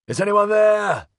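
high, loud call into the dark. A narrator's reading. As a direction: call out into the tunnel. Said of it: "Aveling called".

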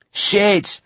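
high panicked cry; panicky. A narrator's reading. Des cursing as lasers hit the lake, panicky. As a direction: yelp it high in alarm. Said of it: "high and panicky".

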